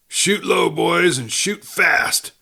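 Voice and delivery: high, strained cry to his men; dying cry. A narrator's reading. Dying man crying orders to his boys, slow urgent cry. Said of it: "he cried aloud".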